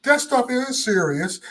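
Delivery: high-pitched, drawn-out imitation; disapproving mama. Son mimics his mama on the mask shirt, disapproving. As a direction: mimic a worried mother's earnest scolding. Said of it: "So my mama look like".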